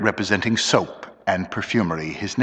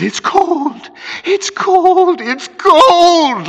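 high wail growing louder; mounting terror; 6 dB louder, much slower than usual. A narrator's reading. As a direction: repeat it, building towards a scream. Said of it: "like that three times".

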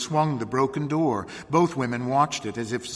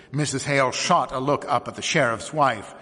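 usual speaking voice

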